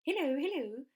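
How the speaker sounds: high loud hippo greeting; Humpy Rumpy hippo. Acted. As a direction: boom out a friendly hello. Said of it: "said Humpy Rumpy".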